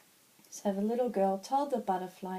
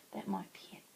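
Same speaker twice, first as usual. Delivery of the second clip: soft unvoiced whisper to himself; 8 dB quieter than usual. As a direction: breathe it out as a thought. Butterfly muses to himself.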